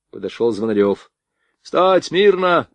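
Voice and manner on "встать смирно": high, slow drawn-out army command; barked command. A narrator's reading. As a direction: call the men to attention. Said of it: "скомандовал Родионов". (громкая армейская команда)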